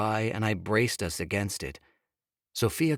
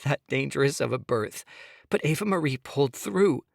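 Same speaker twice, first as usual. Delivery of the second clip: higher, pleading woman's voice; Sophia pleading; 6 semitones higher than usual. Acted. Wife begging husband to hear her, higher pleading. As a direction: plead with him, voice pitched up.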